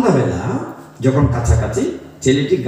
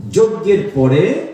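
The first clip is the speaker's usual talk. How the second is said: usual speaking voice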